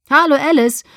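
calling over loud music, high and loud. A narrator's reading. Bella ruft Alice über laute Musik zu, laut. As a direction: call her name over the thumping bass. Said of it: "rief ich über das Gehämmer hinweg".

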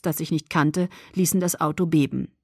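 usual speaking voice

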